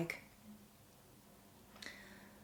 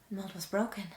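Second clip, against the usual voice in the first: plain reading voice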